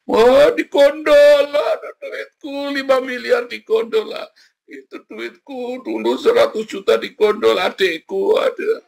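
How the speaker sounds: high, whiny voice of a man who lost his money; mock him, hammed-up and high; whining rich man. Mocking a rich complainer. (suara orang kaya merengek, tinggi dan dibuat-buat)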